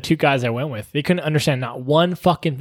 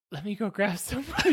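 meek excuse; 4 semitones higher, 9 dB quieter than usual. Retold or imitated. Retells meekly escaping to get help.

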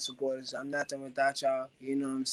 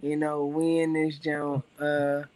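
usual speaking voice

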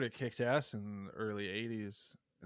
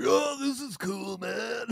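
put-on drunk dude voice; 10 semitones higher, 6 dB louder, slower than usual. Mimicking their drunk younger selves.

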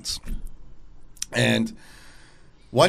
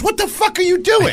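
high shrill-free yelled outburst, loud; shocked yell; 4 dB louder than usual. Retold or imitated. The waitress yelling at the friend in shock. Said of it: "The girl goes".

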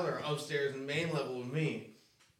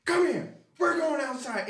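stern mom; 10 semitones higher than usual. Retold or imitated. Mom dragging Jamie outside.

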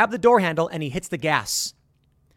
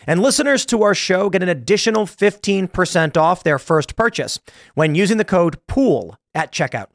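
louder, upbeat sponsor read; 7 dB louder than usual. Acted. Host reads a sponsor spot to listeners, louder upbeat ad voice. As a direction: sell the plants like a radio spot, punching the promo code.

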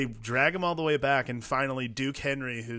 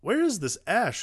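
high, puzzled "where is this Ash"; baffled viewer; 10 semitones higher, 4 dB louder than usual. Retold or imitated. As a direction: ask it as a confused fan, pitch jumping up.

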